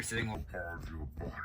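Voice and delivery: menacing voiceless whisper; creepy whisper. Acted. The baby turns to threaten him, a creepy whisper. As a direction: hiss the threat.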